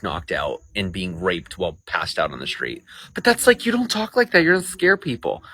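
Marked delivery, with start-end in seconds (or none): 3.1-5.4 mocking critics. Imitating critics' scolding.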